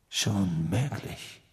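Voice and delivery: very soft, drawn-out reply; brooding murmur. Acted. Sirius weighs whether the wand was stolen, brooding murmur. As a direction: murmur it slowly, non-committal.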